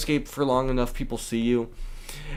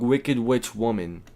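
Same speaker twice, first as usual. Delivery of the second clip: ordinary delivery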